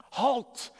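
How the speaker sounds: high, loud scream; screamed halt. A narrator's reading. Commandant stopping a prisoner breaking ranks, screamed. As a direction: stop the prisoner dead with a yell. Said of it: "Fitch screamed".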